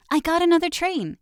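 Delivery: put-on a thrilled child's shout; excited shout. A narrator's reading. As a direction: shout the news to everyone. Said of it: "he shouts to the others".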